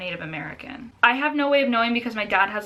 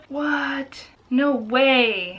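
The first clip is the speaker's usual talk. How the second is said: stunned, higher-pitched reaction; real disbelief; 4 semitones higher, 3 dB louder, much slower than usual. Real, not acted. Woman reading her own DNA results, in disbelief. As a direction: let the surprise lift your voice.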